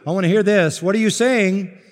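normal talking voice

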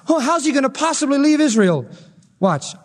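normal talking voice

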